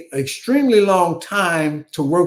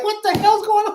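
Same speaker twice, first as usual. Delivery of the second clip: high-pitched exasperated outburst; retold disbelief. On the phone, stunned teenager asks what's happening, high incredulous yelp. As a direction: throw up a shrill what-the-hell.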